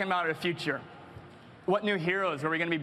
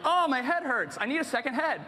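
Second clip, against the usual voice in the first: higher, hammed-up groan of a player; mock headache; 7 semitones higher than usual. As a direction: play a swamped gamer, half joking. Imagined player overwhelmed by drafting.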